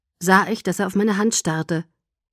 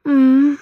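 loud, higher sighing "mm"; sighed hum; 6 semitones higher, 8 dB louder than usual. A narrator's reading. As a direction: hum a sigh with closed lips. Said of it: "seufzte ich". (wohliges, geseufztes „Mm“)

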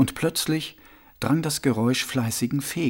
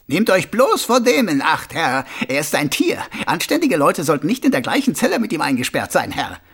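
higher, agitated prisoner pleading with the guard; warnender Häftling Karzer; 4 semitones higher, 4 dB louder than usual. Acted. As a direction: warn the guard insistently, pitch jumping up. Figure complaining to the guard. (warnender, aufgeregter Häftling)

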